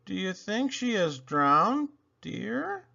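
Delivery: ordinary delivery, nothing marked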